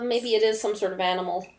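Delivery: ordinary talk, nothing marked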